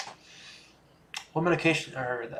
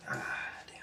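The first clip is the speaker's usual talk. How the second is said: whispered; 12 dB quieter than usual. Real, not acted. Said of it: "it came out like".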